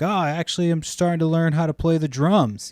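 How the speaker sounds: high, put-on voice for the buyer; older man voice. Music stand buyer explaining his drum lessons, imitated. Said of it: "He was like".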